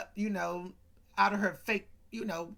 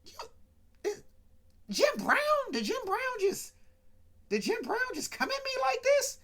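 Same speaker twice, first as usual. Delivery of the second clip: much higher, hammed-up disbelief; mock shocked Geraldine. Geraldine reacting to Jim Brown's pickup line, high mock shock. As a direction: squeal it like she can't believe it. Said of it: "Geraldine turned around and was like".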